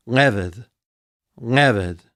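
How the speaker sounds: leved with LE stressed, ved light; stress on first syllable. Shown as a demonstration. Teacher models leved for students, first syllable stressed. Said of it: "With the accent on the first syllable".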